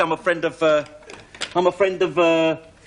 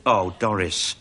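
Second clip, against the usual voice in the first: ordinary talk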